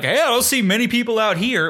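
high, folksy shopkeeper voice; Lantern Guy voice. Retold or imitated. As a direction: greet like a lonely lantern seller. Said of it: "he's like".

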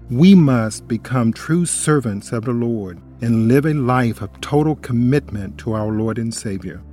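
ordinary talk

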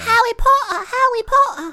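put-on very high excited girl voice; squealing little girl. Ginny spots Harry, very high starstruck squeal. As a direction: squeal the name like a starstruck kid.

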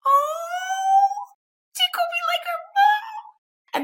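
mock gushing parent. Guests at a gender reveal seeing pink, mock gushing squeal. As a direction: gush like a thrilled party guest. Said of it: "people go like this".

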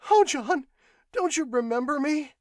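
wife sobbing to her husband to be remembered. Acted. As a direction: beg him through sobs. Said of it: "the woman sobbed".

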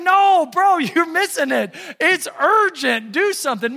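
high, loud exasperated urging; urgent protest. Acted. Onlooker urging the oblivious husband to act, exasperated. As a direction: shout some sense into him, half laughing.

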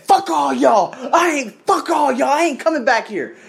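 high-pitched pretend angry quitting; mock outburst. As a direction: act out storming off in a huff. Imagined angry walk-out, acted.

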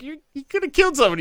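high, rushed protest; mock alarmed reproach. Retold alarmed reproach to the uncle.

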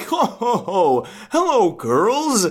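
lightly voiced Santa, dragging the hello; slow, drawn-out mock jolly greeting. Bleeding Santa greeting the girls, hint of jolly Santa.

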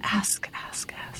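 soft unvoiced whisper of ask. Real, not acted. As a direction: breathe ask three times. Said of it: "whispered repetition".

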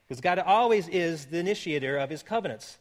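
usual speaking voice

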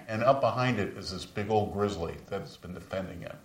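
normal talking voice

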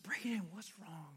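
hushed whisper to a hurting son; a father's private, unvoiced whisper. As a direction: lean in and whisper the question. Quietly asking crying son.

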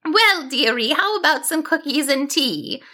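put-on sweet old grandmother, a little puzzled; perplexed kindly grandmother. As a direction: offer tea as a puzzled granny. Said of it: "seeming perplexed but not upset".

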